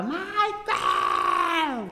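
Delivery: high drawn-out call of a name; mock yell across a club; high, long call like a squawking crow. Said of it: "E eu gritava".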